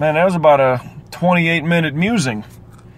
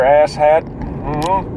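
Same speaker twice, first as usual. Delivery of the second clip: usual speaking voice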